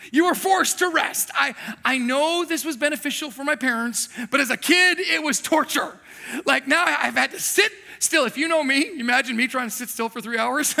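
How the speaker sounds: normal talking voice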